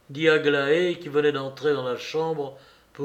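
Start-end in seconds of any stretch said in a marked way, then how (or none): none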